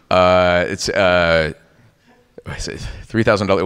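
ordinary delivery

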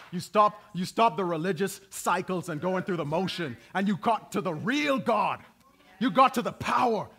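preacher rising to a fervent call; building preaching. Real, not acted. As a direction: climb back up to a shout of praise.

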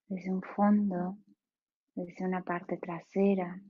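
guided meditation. Acted. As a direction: ease them into stillness, unhurried.

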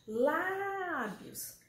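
"Lábios" with a long, high LÁ; stressed syllable drawn out. Shown as a demonstration. Teacher showing pupils the stressed syllable, "Lábios" with LÁ held long. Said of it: "Como a sílaba tônica".

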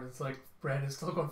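plain reading voice

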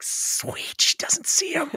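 whispered, breathy aside; a sneaky whispered aside; stage whisper. Retold or imitated. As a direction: hiss it as a sly aside. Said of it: "Unas is like".

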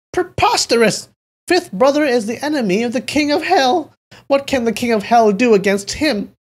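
very high, loud shouted retort; indignant shout. A narrator's reading. Man rejecting news of fifth brother's death, shouting. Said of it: "He shouted".

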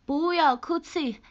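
slow, drawn-out hammed-up consolation; a hint of a soap-opera line; mock dramatic comfort. Streamer consoling a fan who cried, hammed-up slow.

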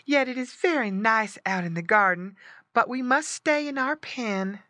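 high, reluctant pig voice; sighing mother pig. A narrator's reading. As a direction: regret leaving as mama pig. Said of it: "with a sigh".

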